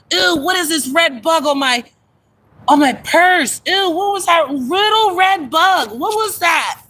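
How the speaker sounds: high-pitched squeamish freak-out; startled disgust. Real, not acted. Streamer spotting a red bug on her purse, startled and grossed out. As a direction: recoil in alarmed disgust, pitch jumping up.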